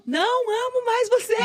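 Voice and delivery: high, loud, half-laughing sob; laugh-crying rejection of God; tearful cry at God. Retold or imitated. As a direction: throw it at the sky through laughing tears.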